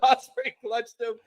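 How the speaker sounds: usual speaking voice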